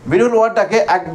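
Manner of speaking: normal talking voice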